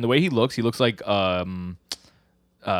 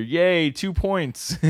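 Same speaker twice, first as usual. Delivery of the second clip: high, slow, drawn-out cheer; hammed-up gleeful hooray; mock cheer; 9 semitones higher, much slower than usual. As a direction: celebrate in a put-on high voice. Acted cheer after spitting.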